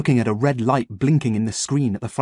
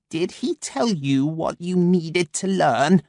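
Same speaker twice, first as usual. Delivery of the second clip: crow voice; 6 semitones higher, slower than usual. Acted. Crow Freya, slow.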